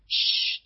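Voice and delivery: a sharp, drawn-out shushing hiss. A narrator's reading. Trip hushes his siblings during the show, sharp shushing hiss. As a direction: hush everyone so the show can be heard. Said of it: "intette le őket Trip".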